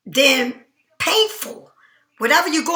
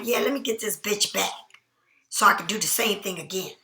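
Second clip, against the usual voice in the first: low, softer aside as a private thought; scheming inner voice; 4 semitones lower, 7 dB quieter, faster than usual. Acted. Faker privately plotting to win someone back, quiet inner voice. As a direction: say it under the voice, like a secret plan. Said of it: "but inside they like".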